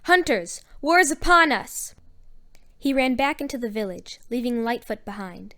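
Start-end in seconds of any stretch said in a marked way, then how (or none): 0.0-1.9 high, loud alarm call; raised, urgent war cry; yelling. A narrator's reading. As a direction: sound the alarm to the hunters.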